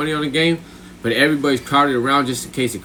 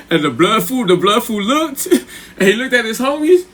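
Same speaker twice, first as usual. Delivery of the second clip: high, laughter breaking into the telling; laughing while talking; 8 semitones higher, 3 dB louder than usual. Real, not acted. Telling how the opponent looked at his homies, laughing. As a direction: struggle through the giggles, pitch rising.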